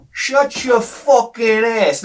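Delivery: high, slow tough-guy line; drawn-out mock snarl; kid mouthing off. Anthony in the bathroom, slow high mock snarl. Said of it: "and he goes".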